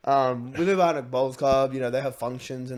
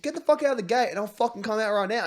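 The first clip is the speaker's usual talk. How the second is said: pissed off; 8 semitones higher, 4 dB louder than usual. Retold or imitated. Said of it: "And I was pissed".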